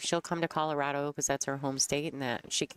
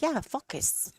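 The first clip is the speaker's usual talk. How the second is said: high-pitched mimicked French Canadian "focus", short vowel like "fuckus"; French Canadian accent; 12 semitones higher, slower than usual. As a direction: imitate a French Canadian insisting on "focus", sounding like a swear. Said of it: "say it in the French Canadian way".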